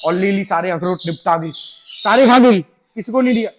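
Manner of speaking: indignant outburst. Real, not acted. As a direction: exclaim loudly that Lily finished it all. Complaining bird ate everything. (Lily की शिकायत करते हुए ज़ोर से, ऊँची आवाज़ में)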